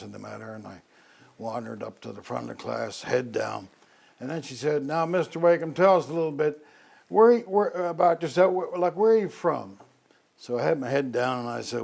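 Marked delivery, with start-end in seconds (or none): 4.8-9.8 higher, louder Southern Virginian drawl, prodding; mock the Southern Virginian nun pressing him, pitched up and louder. Nun teacher pushing new boy to speak, loud Southern Virginian drawl. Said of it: "very distinctive Southern Virginian drawl".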